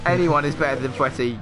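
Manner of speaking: ordinary talk, nothing marked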